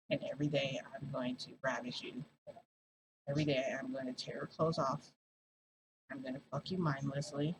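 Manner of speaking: put-on low, hushed possessive boyfriend. Boyfriend's possessive threats, voiced.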